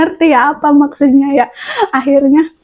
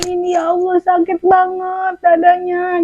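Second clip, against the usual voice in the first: higher, softer pained voice; soft moan of pain; 5 semitones higher, 3 dB quieter than usual. Retold or imitated. Patient telling the doctor her chest hurts, soft moan. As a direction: whimper it out.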